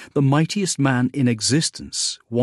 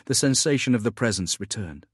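plain reading voice

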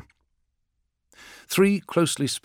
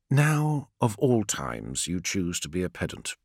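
condescending; 5 semitones lower, 6 dB quieter than usual. A narrator's reading. Call scolding Friedrich for pedantry, condescending. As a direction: let it sink lower and softer, unimpressed.

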